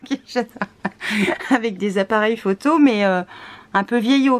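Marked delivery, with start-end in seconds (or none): 0.1-0.5 Kinshasa through a laugh; amused, laughing. Real, not acted. Vlogger repeating the name she stumbled on, laughing. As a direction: say Kinshasa while still giggling.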